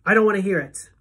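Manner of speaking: loud, frantic shout; shouting refusal. Acted. Boy shouting at the principal to block out news of his father. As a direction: yell it out to block her words.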